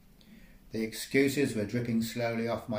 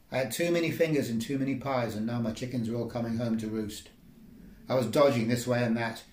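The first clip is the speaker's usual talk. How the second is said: plain reading voice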